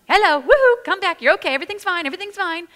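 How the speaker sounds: put-on high, loud singsong cheer to pull baby back; cheerful call. Shown as a demonstration. Mother calling her baby to stop fussing, loud cheerful singsong. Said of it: "sometimes it's cheerful".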